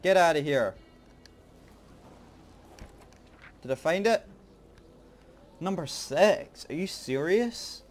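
loud, high disbelief; can't believe it, shouting; surprised. Real, not acted. Disbelief at finding number six.

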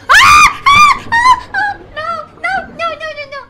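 terrified screams. Real, not acted. Streamer watching Lara die, screaming no. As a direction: scream in terror. Character dying.

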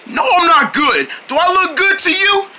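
high, exasperated retort; indignant. Acted. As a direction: shout it back, sore at the silly question. Beaten fighter snaps at friend.

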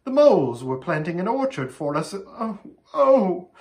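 high tearful lament breaking into wails; distraught, weepy character voice; acted sobbing. A narrator's reading. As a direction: wail with regret, dissolving into sobs. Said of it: "buried her face in her hands and sobbed".

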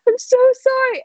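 high-pitched, acted tearful wail; tearful apology. Panicked Gertrude crying to the others, tearful apology. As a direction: apologise through mock tears, voice way up high. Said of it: "crying and saying".

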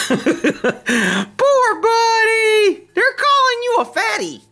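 put-on very high, drawn-out pitying baby voice; mock-sympathise with the horse like a baby; mock pity pet talk.

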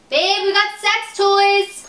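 excited shout to another room; calling out. Real, not acted. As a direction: shout the news to her husband, slow and drawn out. Calling out to husband.

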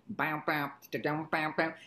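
twangy "bam, bam" mouth-music; rhythmic imitation of a plucked instrument. Shown as a demonstration. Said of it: "I'd go like".

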